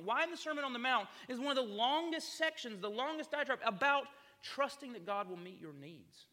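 loud, high, impassioned sermon delivery; preaching at full force. Acted. Pastor pressing Jesus' words on the congregation, loud and fervent. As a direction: drive the point home from the pulpit.

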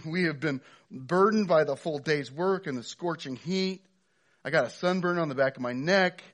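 loud, high complaining laborer; whiny grumbling worker. Acted. Laborer griping to the landowner, loud and high. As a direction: gripe at full volume about the long day.

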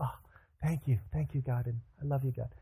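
a quiet, relieved thank-you; hushed gratitude. Acted. Abraham thanking God for his spared son, hushed gratitude. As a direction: softly breathe out thanks to God.

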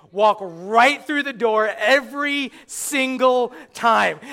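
slow, loud, each word spelled out; exasperated. Acted. Comic complaining about horror victims, slow and exasperated. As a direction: drag it out in disbelief.

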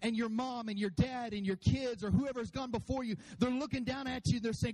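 usual speaking voice

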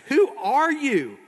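high, loud, drawn-out question; confronting demand. Acted. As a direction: demand it slowly, word by word. Dorothy confronts the exposed man.

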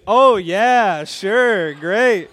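loud, sarcastic oh yeah sure; wife answering the kid with hammed-up enthusiasm; mock enthusiasm. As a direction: play it big and fake-pleased. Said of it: "she goes".

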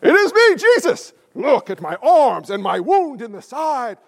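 hammed-up risen Jesus reveal; grand mock Jesus. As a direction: announce yourself with theatrical glory. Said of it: "Cleopas is like".

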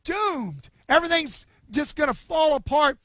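high, loud, breathless panic; a frantic young servant out of breath; panicked servant. Retold or imitated. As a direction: blurt it out like a terrified servant. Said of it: "gasping for breath".

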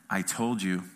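low, quiet I-told-you-so; reproachful wife. Retold or imitated. Wife to husband after the son's punch, quietly reproachful. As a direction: say it softly and pointedly, pitch dropped. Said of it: "those eyes, and she went".

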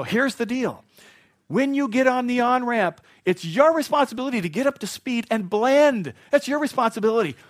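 loud, high-pitched lecture at other drivers; angry driver's rant. Acted. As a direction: rant at bad mergers, hammed-up. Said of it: "Do I sound angry?".

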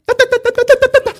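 very high sung da-da rhythm; scatted clap rhythm. Retold or imitated. Pastor imitating the weird clapping rhythm, high and sung. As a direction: sing the odd clapping beat on da. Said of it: "It was like".

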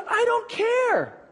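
高声慢说的强调反驳; emphatic retort. Retold or imitated. Retelling his emphatic reply.

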